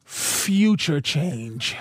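low, slow dramatic title; trailer announcer voice. Pretend. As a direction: sell it like a cheap sci-fi trailer.

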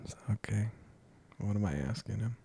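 soft unvoiced whisper to himself; whispered confusion; whispered puzzling. Real, not acted. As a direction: mutter it under your breath, lost. Puzzling aloud mid-exercise.

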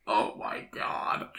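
breathless whisper, slow; whispered oh my god. Real, not acted. Host reacting to the incest story, whispered. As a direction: gasp it out under your breath.

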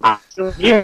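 hollow, distant call audio; bad headset mic, like in a tunnel; sounds like in a tunnel. Real, not acted. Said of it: "It sounds really weird".

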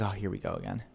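soft, low exasperated sigh; weary. Retold or imitated. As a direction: roll your eyes quietly. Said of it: "you're just like".

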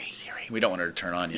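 hushed whisper so Siri won't hear. Real, not acted. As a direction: whisper it under your breath. Hushing so Siri won't wake.

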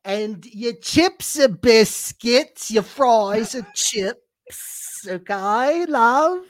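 very slow, high fake London accent; drawn-out British teasing; mock British accent. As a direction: stretch each word like a mock Brit.

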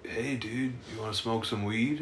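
hushed, low-key offer; quiet offer. Retold or imitated. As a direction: slip the offer in softly. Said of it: "Buddy was like".

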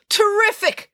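high, exasperated shout; sarcastic shout. Acted. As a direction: shout it in bitter outrage. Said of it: "shouted Ian".

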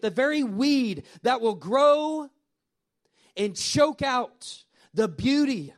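slow preacher's emphasis; slow, weighty build. Real, not acted. Pastor to congregation, slow and weighty. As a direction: lay each phrase down slowly, stretching it out.

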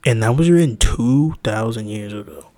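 country bigot laying down the law; country accent. Acted. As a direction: lay on a loud country accent. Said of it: "I always do country".

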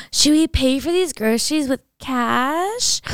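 mock amazed rich girl. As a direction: gush slowly like it's a big adventure. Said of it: "They're like, gasps".

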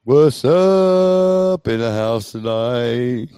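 loud, slow drawn-out hype; hype greeting. Acted. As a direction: stretch it out like a hype man.

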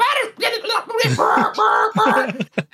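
high burst of garbled honks and squawks; imitated censor noises. Friend imitating Mister Krabs' mom cursing, high honking gibberish. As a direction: rattle off cartoon bleep noises like cursing. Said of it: "stubs her toe and goes off. It's like".

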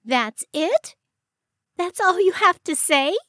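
high, indignant outburst; rising, outraged disbelief; fuming with rage. A narrator's reading. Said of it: "Iris was fuming with rage".

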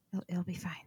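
hushed whisper, almost no voice. Pretend. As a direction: breathe it like a secret. Joking aside about cooking episode.

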